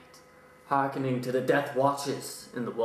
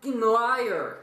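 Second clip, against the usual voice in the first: mock outrage; 7 semitones higher, slower than usual. Mock accusation to Poe.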